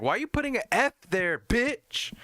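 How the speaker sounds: hammed-up indignant yelling; mock outrage. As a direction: rant at them in mock fury. Mock rant at Londoners.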